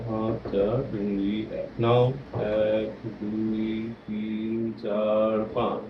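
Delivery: slow count fading to almost nothing; slow breathing count. Shown as a demonstration. Therapist pacing a child's breathing, slow and fading. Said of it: "দেখো, আমাকে দেখো".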